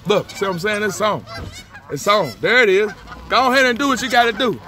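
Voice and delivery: egging on the fight like a pumped-up duck; hyped-up duck voice. Retold or imitated. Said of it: "He like".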